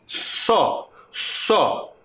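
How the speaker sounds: só isolated and repeated; syllable sounded out. Shown as a demonstration. Teacher isolating a syllable for the class. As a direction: say só alone, twice. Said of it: "quando eu digo".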